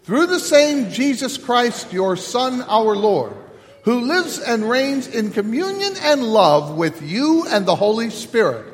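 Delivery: measured, solemn liturgical chant-like reading; leading the liturgy. Acted. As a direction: intone the service to the congregation, unhurried. Pastor leads liturgy and prayers.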